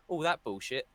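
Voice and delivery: ordinary talk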